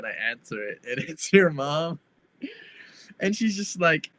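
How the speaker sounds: usual speaking voice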